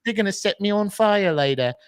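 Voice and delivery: hammed-up poor little English boy; pitiful British child. Harry complains at the start of the movie, mock pitiful British child.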